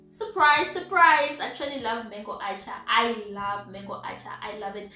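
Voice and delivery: ordinary talk, nothing marked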